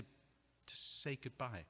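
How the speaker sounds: a small ache under the words; quiet sad farewell. Acted. Heartbroken man asking for one last goodbye to the apartment, quietly sad. Asks a moment alone to grieve.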